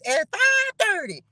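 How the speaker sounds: high, put-on voice of an impatient viewer; mock whiny viewer. Viewers teasing the late host, high mock whine. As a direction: imitate the nagging latecomers. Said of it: "little people them gonna be like".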